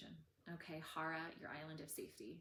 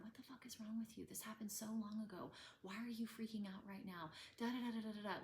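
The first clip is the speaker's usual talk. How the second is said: higher, quick self-scolding rant; harsh inner critic; 6 semitones higher than usual. Retold or imitated. Woman mocking her inner critic, a mild harsh rant. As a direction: berate yourself fast. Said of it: "all these internal thoughts".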